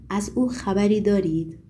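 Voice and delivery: ordinary delivery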